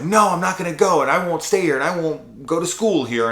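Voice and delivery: high, loud mock-defiant girl. Imitating a girl refusing school, mock defiance. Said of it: "a girl that's like".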